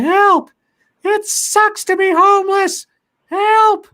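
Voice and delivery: high, loud, slow falsetto wail; imitated screaming. Mimicking a homeless woman yelling at night, drawn-out high wail. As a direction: wail each word out long. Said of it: "she started changing it up".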